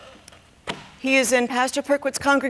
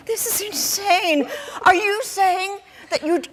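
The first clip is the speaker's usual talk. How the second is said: raised, agitated pitch; horrified disbelief; 7 semitones higher than usual. Acted. Mother learning daughter drove suitors away, high and aghast. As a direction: reel in high-pitched dismay.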